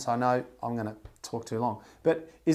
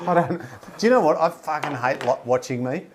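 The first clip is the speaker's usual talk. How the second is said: ordinary talk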